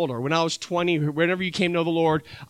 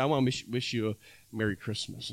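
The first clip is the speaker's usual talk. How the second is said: much softer, lower holiday greeting; gentle greeting; 8 semitones lower, 10 dB quieter than usual. Pretend. To the widow next door, a soft gentle greeting. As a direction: hand her the plate and wish her softly.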